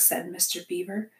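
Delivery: ordinary delivery, nothing marked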